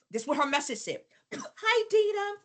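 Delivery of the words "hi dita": much higher bright greeting, imitating the coach; chirpy caller greeting. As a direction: mimic a sunny sales voice saying hi. Reading coach's message in her voice.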